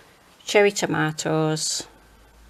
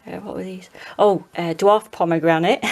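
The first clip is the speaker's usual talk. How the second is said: ordinary talk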